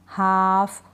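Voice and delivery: हाफ with a long vowel straight into F; isolated model word हाफ, L left out; silent L. Shown as a demonstration. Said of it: "silent है".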